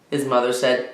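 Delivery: ordinary delivery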